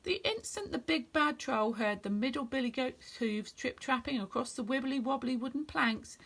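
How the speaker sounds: usual speaking voice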